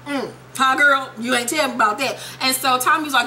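ordinary talk

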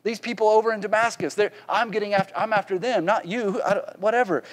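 a hint of a flustered Saul brushing it off; defensive Saul. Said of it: "someone like Saul being like".

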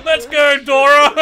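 loud high laughing cheers; laughing shouts. Real, not acted. Chaotic car chase.

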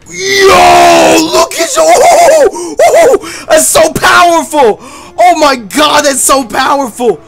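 high, loud, slow hype yells; excited shouting. Real, not acted. Streamer hyped by Lugia on screen, shouting in awe. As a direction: lose it over a legendary Pokémon.

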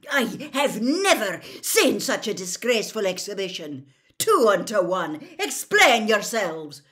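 loud, high, furious telling-off; teacher quivering with rage; outraged scolding. A narrator's reading.

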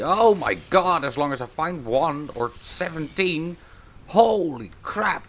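high, loud burst of excitement; excited shout. Real, not acted. Gamer spotting many bugs, high excited yell. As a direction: blurt it out, thrilled and shocked. Spots a swarm of creatures.

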